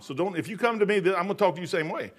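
normal talking voice

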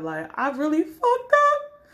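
drawn-out fake wail climbing high; hammed-up crying confession; mock tearful. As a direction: whine it slowly as if about to cry. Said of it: "and start crying".

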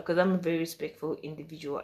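ordinary delivery

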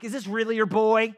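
acted-out demanding question; mock interrogation. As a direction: put the parents on the spot, loudly.

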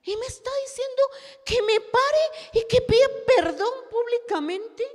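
high, loud indignant outburst; outraged exclamation. Real, not acted. Telling the church of the husband's demand, outraged and loud. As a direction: spit out the injustice at the top of the voice. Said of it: "outraged retelling".

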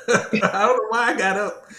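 high, loud, real laughing bewilderment. Real, not acted. Man recalling being called up in church, laughing. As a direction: laugh helplessly through the words. Laughs at his own reaction.

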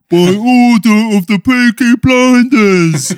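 loud, high, slow hammed-up Brummie accent; Peaky Blinders Brummie. Friends doing a Peaky Blinders line in mock Brummie accent. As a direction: bellow it like a mock Brummie gangster. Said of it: "a little Peaky Blinders impression".

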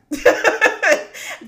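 loud high burst of laughter; real laugh. Real, not acted. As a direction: laugh it out at herself. Laughs at her own story.

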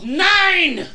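put-on high, loud German-accented shout. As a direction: yell it like a mock German. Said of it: "I'm sorry, German".

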